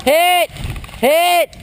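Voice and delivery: high sharp shout; calling out hit. Real, not acted. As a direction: yell that you've been hit. Player calls out being hit.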